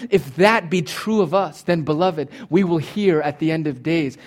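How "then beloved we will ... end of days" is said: usual speaking voice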